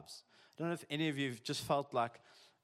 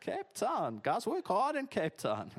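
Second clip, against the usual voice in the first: sarcastic quip; 9 semitones higher, slower than usual. Acted. Sarcastic quip about Cape Town.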